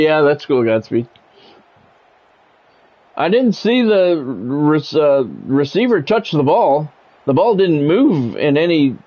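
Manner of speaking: ordinary delivery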